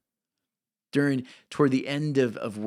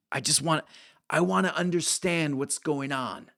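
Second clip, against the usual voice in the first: put-on old New Yorker asking, a bit higher; old man quizzing Mark in a New York accent; 4 semitones higher than usual. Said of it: "like, New York kind of accent".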